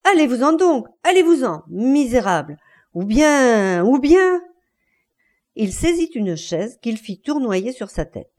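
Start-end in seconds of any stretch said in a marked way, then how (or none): 0.0-4.4 loud, high furious orders; angry shouting. Acted. Enraged husband orders wife out, shouting. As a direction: shout them out, threat hanging at the end. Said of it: "se mit à crier".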